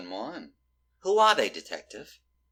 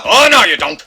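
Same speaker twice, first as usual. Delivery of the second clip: high, very loud interception cry; shouted challenge; 8 semitones higher, 14 dB louder than usual. Acted. Detective blocking the handoff, shouting. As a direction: yell as you block the escape.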